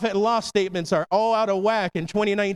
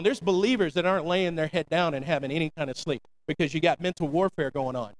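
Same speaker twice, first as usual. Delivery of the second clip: ordinary talk, nothing marked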